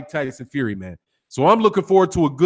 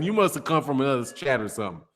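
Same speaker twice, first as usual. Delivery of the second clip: ordinary delivery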